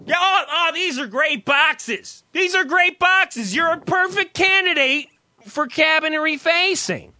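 high, loud booming salesman. Retold or imitated. Storyteller imitating the booming cabinet salesman, loud and high. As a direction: boom it out like an overeager salesman. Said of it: "his voice is fucking booming".

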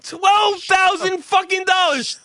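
high, loud indignant shout; outraged shout. Real, not acted. Telling his friend the price, real outraged shout.

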